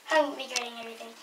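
usual speaking voice

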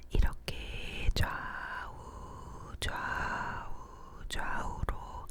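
drawn-out ASMR whisper panning side to side; slow left-right whisper. Shown as a demonstration. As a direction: whisper slowly from ear to ear. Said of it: "좌우로 속닥, 속닥 하는 위스퍼링".

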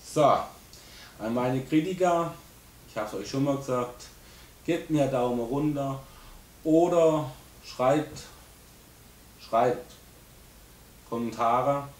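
loud, slow and pointed; stern address. Real, not acted. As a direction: call out the critics firmly. Addressing critics directly. (strenge, laute Ansage)

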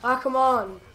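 normal talking voice

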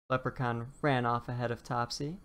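ordinary delivery

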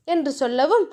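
plain reading voice

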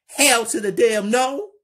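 high, loud outraged shriek of refusal. Acted. Kelly refusing Lena, outraged high loud shriek. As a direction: blast it out like she'll never agree. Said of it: "Kelly shaking her head".

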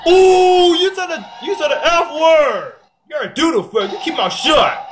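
shocked sing-song tattle; scandalized. Real, not acted. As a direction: catch someone swearing and crow about it. Tattling on a swear word.

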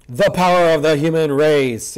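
drawn-out, booming heroic proclamation; grandiose declaration. Acted. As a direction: declare it slowly, like a grand battle cry. Mock heroic line read from manga.

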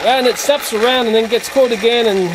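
usual speaking voice

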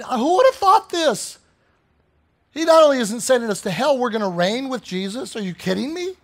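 excited preacher, pitch leaping up at the start. Real, not acted. Preacher marvelling to congregation at God's gift, excited. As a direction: burst out in amazed excitement. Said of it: "Pardon me that I'm a little excited".

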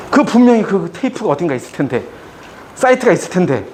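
usual speaking voice

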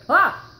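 real sudden high, loud scream of fright; startled scream. Real, not acted. Player startled by a friend sneaking up, real loud scream. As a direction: jump and shriek at the surprise. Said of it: "you scared the crap out of me".

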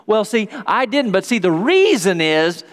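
high-pitched, wheedling self-justification; excuse-making. Acted. Preacher mimicking people justifying what they do, defensive.

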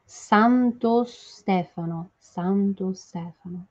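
Santo Stefano slow, clean o before Stefano; teacher modelling Santo Stefano; right pronunciation. Shown as a demonstration. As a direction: say Santo Stefano with no extra vowel. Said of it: "Vamos fazer primeiro a pronúncia?".

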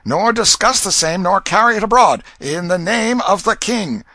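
high, forceful official proclamation; royal herald's proclamation. A narrator's reading. Proclaiming the king's order against false and foolish matter, loud herald's cry.

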